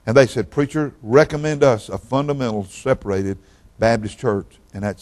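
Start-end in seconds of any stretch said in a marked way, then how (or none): none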